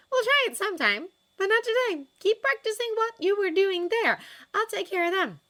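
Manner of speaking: high, loud character voice; animated, insistent girlfriend; Astrid negotiating. A narrator's reading.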